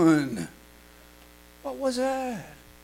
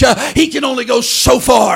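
preached loud; 4 semitones higher, 10 dB louder than usual. Acted. Preached line.

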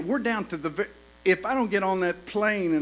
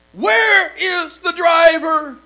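high, slow, shouted demand; angry shout; 10 semitones higher, 12 dB louder, much slower than usual. Shown as a demonstration. Preacher hamming up his call to the hotel, shouting. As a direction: bellow it down the phone. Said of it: "Didn't say it like that".

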